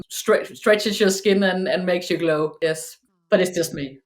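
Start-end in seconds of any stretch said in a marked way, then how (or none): none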